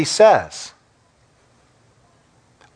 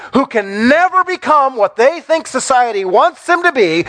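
higher, rising fervent sermon voice; preaching at full force; 7 semitones higher than usual. Acted. Pastor pressing the congregation about exclusivity, fervent preaching. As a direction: build it up like a revival preacher.

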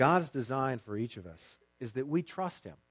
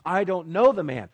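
a firm, louder brushing-off denial; 5 semitones higher, 9 dB louder than usual. Acted. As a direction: deny it flatly and firmly. Peter denies amid questioning.

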